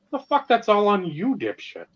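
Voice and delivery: high, heated scolding; angry rant. Retold or imitated. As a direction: berate him, voice way up. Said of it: "It's like".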